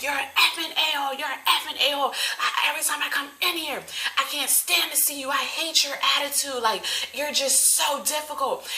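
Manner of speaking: high-pitched mock yelling without real volume; imitated screaming rant. Customer berating a Burger King worker, mock screaming. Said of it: "She's screaming and yelling".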